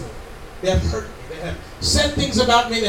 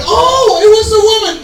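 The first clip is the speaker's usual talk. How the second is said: high, loud sarcastic sneer; mock the excuse with exaggerated disbelief; 11 semitones higher, 8 dB louder than usual. Preacher mocking Adam's excuse as God. Mocking God's imagined reply.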